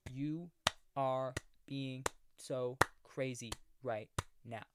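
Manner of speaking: lightly hammed-up sass, drawn out; mock clapback. Shown as a demonstration. Mocking how Blumhouse characters clap back, soft sassy voice. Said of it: "Like that".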